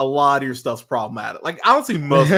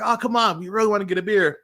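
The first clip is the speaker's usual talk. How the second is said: higher, lightly voiced pushy invitation; mock coaxing cops; 8 semitones higher, faster than usual. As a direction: wheedle him along, pitched up.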